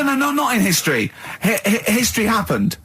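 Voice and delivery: high, incredulous correction; can't believe what he's hearing; exasperated protest. Real, not acted. Arguing cyclops isn't history.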